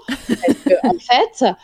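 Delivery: laughing through the words; quoted retort with a laugh. Retold or imitated. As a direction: break into a laugh mid-sentence.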